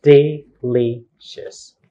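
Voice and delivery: "Delicious" in three slow, separate beats; De... li... cious, pause between syllables; counting syllables. Shown as a demonstration. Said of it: "How many syllables?".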